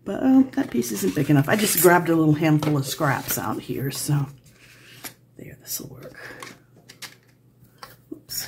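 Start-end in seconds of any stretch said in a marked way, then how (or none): 5.4-8.5 muttered whisper. Real, not acted. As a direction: whisper it to yourself. Muttering to herself.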